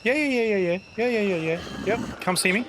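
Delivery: high sing-song taunt. Real, not acted. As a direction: call them over, teasing. Luring zombies, bait and switch.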